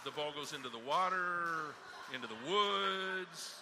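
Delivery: loud, long-held final words; drawn-out comic announcement. Acted. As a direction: stretch and swell each landing place for laughs. Comic climax of swearing joke.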